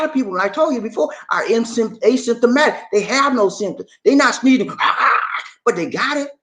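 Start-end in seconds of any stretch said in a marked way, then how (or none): 4.7-5.4 breathy unvoiced sneeze sounds; mock sneeze. Host showing a sneeze, faked lightly and unvoiced. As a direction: fake a little sneeze.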